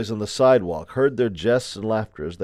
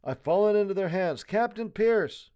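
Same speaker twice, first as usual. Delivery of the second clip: much higher, strained-for-help appeal; desperate pleading; 10 semitones higher than usual. A narrator's reading. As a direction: plead with departing men by name. Prisoner pleads as troops leave.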